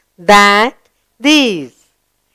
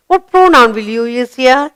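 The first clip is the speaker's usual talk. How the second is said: ordinary talk, nothing marked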